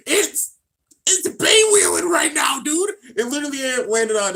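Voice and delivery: excited shout. Real, not acted. Reacting to the bay hitting the wall, excited shout. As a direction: hype it up, shout the hit.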